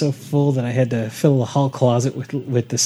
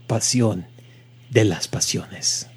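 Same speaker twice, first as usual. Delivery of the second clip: slow, lower, sultry Spanish; sexy Spanish accent; 3 semitones lower, slower than usual. Acted. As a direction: drop low and linger on the Spanish. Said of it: "in the sexy Spanish accent".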